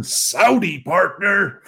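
lightly voiced western cowboy; mock cowboy. Jokey Saudi-western catchphrase.

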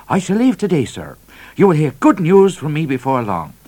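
high, loud trooper promise; confident trooper. A narrator's reading. Trooper promising the governor good news, confident. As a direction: announce it briskly up high, dropping on "sir".

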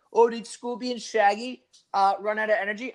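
a touch of a cooing mother; mock mother voice. Mocking the kid's mother.

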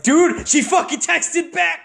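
very high, drawn-out excited squeal; giddy excitement. Retold or imitated. Telling a friend she texted back, giddy excitement. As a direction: re-enact being thrilled, voice shooting way up. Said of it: "I would get so excited".

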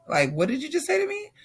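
high-pitched incredulous question; retold shock, voice jumping up; stunned disbelief. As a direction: shoot up high, as if you misheard. Retells shock at hearing diagnosis.

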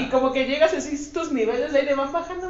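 normal talking voice

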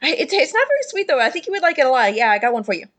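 high, hurried at first, settling down; eager Phineas. Acted. Drunk Phineas offering his friend a cake, eager and high. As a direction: earnestly offer the linty cake. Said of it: "and he says".

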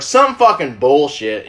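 ordinary delivery

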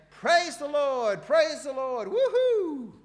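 very high, loud, slow joyful cry; ecstatic praise shout. Retold or imitated. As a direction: shout it out dancing with joy. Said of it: "suddenly is dancing around saying".

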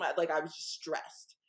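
usual speaking voice

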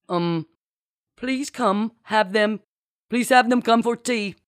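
halting, high, put-on command; improvising fake captain. A narrator's reading. Marcy improvises orders as captain, awkwardly. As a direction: stumble through it in a put-on voice. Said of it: "Marcy improvised with an embarrassed grimace".

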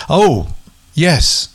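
usual speaking voice

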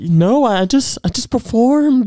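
mock innocent.

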